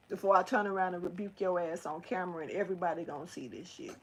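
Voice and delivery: low, hushed real scolding; quiet warning. Real, not acted. Owner warning Zeke to stop, quiet and low. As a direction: warn him under your breath, voice held down. Warns the pet directly, off to side.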